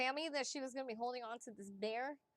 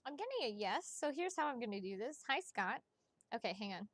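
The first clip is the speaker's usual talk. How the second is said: ordinary talk, nothing marked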